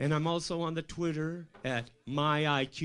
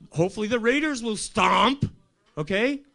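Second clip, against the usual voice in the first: higher, louder rally cry; fired-up pep talk; 8 semitones higher, 7 dB louder than usual. Retold or imitated. Advising Trump on his campaign, fired-up cheering. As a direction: pump him up like a coach.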